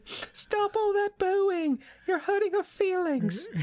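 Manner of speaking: very high, slow mock scolding; a high, hammed-up voice hushing the booing crowd; mock scolding host. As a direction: tell the crowd off in a squeaky voice.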